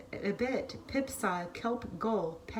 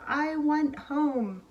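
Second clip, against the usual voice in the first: higher and slow, a lightly acted wail; 6 semitones higher, 4 dB louder, much slower than usual. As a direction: draw it out like a lost little chick. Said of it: "she wailed".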